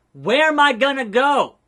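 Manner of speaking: high, loud mock-desperate wail; loud dismay. Fired wrestler begging boss, loud dismay. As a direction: cry it out, drawing out the words. Repeats the question, escalating.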